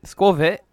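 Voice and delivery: Squavit popped high and loud; funny Squavit. Shown as a demonstration. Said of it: "Okay, you did it".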